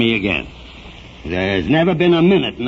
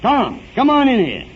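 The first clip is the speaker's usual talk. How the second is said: higher, raised call across the room; 10 semitones higher than usual. Acted. Mayor calls Tom into his office. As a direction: call him in from the next room.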